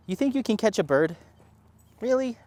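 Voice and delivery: high teasing pet voice; high dog-talk voice. Real, not acted. Talking to dog.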